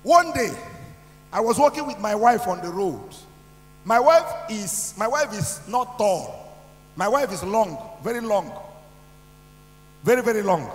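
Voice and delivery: preaching at full force. Real, not acted.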